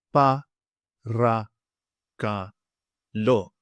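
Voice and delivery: "Pa, ra, ka, lo" with a pause after each; teacher sounding out "Pa, ra, ka, lo" one syllable at a time. Shown as a demonstration. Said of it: "I'll say it for you now".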